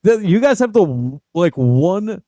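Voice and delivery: sloppy imitation of a Boston accent with dropped r's; mock Boston accent. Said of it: "the same thing as a Boston accent".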